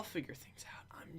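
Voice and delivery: unvoiced whisper to herself. Real, not acted. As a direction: whisper it under your breath. Muttering to herself while drawing.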